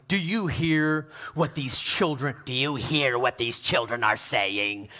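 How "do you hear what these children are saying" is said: mock-indignant chief priest in a low voice; low, theatrical religious outrage, played up. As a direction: put on a hammed-up, low indignant voice. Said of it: "they were indignant".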